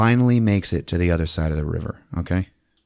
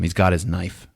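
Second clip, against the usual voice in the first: ordinary talk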